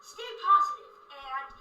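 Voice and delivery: loud, punchy outro sign-off; signature sign-off. Acted. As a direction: belt the catchphrase to end the video. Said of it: "I would end it with".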